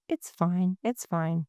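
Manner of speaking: kind, hushed comforting tone; soft and warm. Shown as a demonstration. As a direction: say it softly to soothe a friend. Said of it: "the tone really, really matters".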